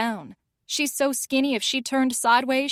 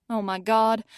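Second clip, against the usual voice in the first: ordinary delivery, nothing marked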